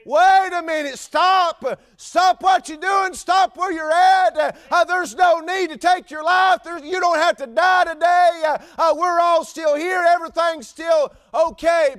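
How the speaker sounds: loud, high urgent shout; Paul's cry. Acted. Paul calls to the jailer not to kill himself, loud high shout. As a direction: cry out to stop him. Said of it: "Paul cried".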